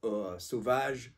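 eau forte with a light French accent, R in the throat; French pronunciation. Shown as a demonstration. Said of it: "If it was French".

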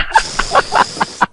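long hissing blast-off noise; rocket whoosh. Shown as a demonstration. Caller showing how his rocket ship goes, long whooshing noise. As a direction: imitate a rocket taking off with your breath. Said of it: "and I go".

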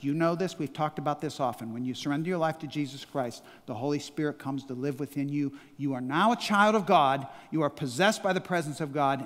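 usual speaking voice

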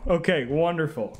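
ordinary talk

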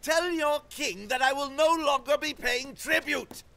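high, spat-out king's defiance; spitting contempt. Acted. King sends defiant message to David, high and spitting. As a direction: spit the words at them. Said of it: "he spat as he spoke".

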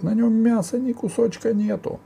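very high ogre voice; protesting ogre. A narrator's reading. Ogre excusing himself to Sila, high-pitched protest. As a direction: plead your innocence in a high ogre cry. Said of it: "закричал людоед".

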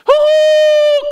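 very high, loud train hoot; train whistle call. Acted. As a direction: wail like a steam whistle. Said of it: "called the train".

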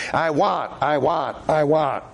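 plain Midwestern take on the same words; the Midwestern version of the Southern line; preacher redoes his relatives' words in plain Midwestern English. Shown as a demonstration. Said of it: "Let me put it back in Midwestern English. It sounds better".

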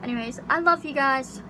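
ordinary delivery

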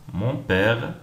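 normal talking voice

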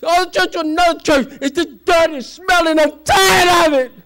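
high, shouted sermon climax; full-force preaching. Real, not acted. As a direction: cry it out to the congregation, dragging the last words.

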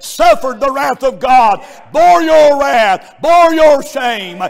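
high, loud shouted preaching; preaching at full force. Acted. Preacher shouting God's love to the congregation. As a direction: shout it to the back pews.

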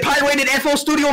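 ordinary delivery

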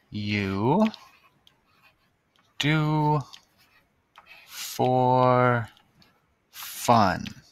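each word spaced out slowly; slow dictation. Shown as a demonstration. Teacher dictating to the class, slowly. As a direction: sound it out word by word as you write.

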